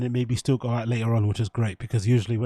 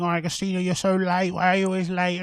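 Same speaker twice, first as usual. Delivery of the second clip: higher, whiny mock voice of nagging chatters; 6 semitones higher than usual. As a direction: mimic the nagging chat in a whiny, sneering voice. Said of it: "all the people in the chat that are saying".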